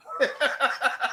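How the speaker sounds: high burst of laughter; laughing. Real, not acted. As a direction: laugh at the punchline. Laughter after the second punchline.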